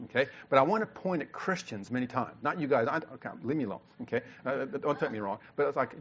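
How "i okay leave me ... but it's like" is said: ordinary talk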